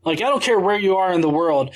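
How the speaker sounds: ordinary talk, nothing marked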